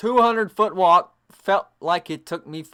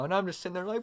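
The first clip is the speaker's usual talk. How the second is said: ordinary talk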